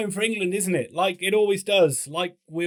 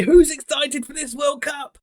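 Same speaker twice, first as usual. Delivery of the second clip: high, loud, drawn-out hype call; stretched-out, excited presenter yell; hyped-up shout; 9 semitones higher, 5 dB louder than usual. Pretend. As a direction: rouse the crowd with a big shouted question. Hype call before the rundown.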